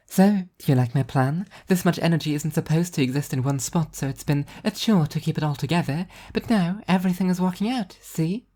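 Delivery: high, bright cheerful angel voice; an upbeat angel showing off her plan; high, cheerful divine woman; cheerful angel Arbitrage. A narrator's reading.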